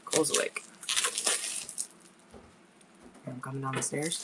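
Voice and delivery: soft hushed whisper. Real, not acted. Mother whispering as her son wakes, hushed. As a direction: keep your voice down.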